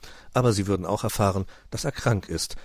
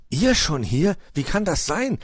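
high, astonished questioning; startled, pitch leaping up; taken by surprise; 9 semitones higher, 5 dB louder than usual. A narrator's reading. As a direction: ask as if caught completely off guard. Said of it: "fragte Puh von ihrem plötzlichen Auftauchen völlig überrumpelt".

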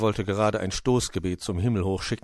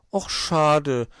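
high, loud letdown; disappointed; 8 semitones higher, 7 dB louder than usual. A narrator's reading. Borstel is told he is too small, disappointed. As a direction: sigh it out as a let-down youngster. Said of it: "war Borstel enttäuscht".

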